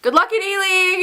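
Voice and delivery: high, bright retold call; calling after someone. Fan calling after Peter as he leaves, eager call.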